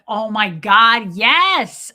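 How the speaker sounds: loud, drawn out, rising on the yes; re-enacted delighted outburst, slow and loud; gushing enthusiasm. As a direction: gush as if thrilled to be asked. Retold gushing answer about garlic.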